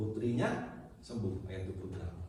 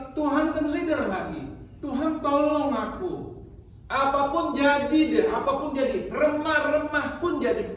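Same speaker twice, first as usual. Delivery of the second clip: high, loud impassioned preaching; a preacher voicing the woman's desperate begging, high and loud; preaching at full force; 14 semitones higher, 9 dB louder than usual. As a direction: plead her case to the congregation at full cry.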